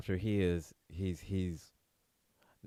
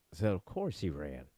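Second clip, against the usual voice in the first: normal talking voice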